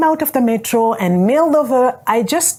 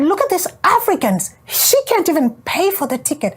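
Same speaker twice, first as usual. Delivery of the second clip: mock scorn; 7 semitones higher than usual. Onlookers scorn her for fence jumping.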